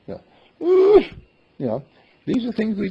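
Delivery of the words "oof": sharp, very high yelp of a pretend wounded ego; a squeaky, hurt little oof; high, loud comic grunt of dismay; mock oof. Mock reaction to an insult.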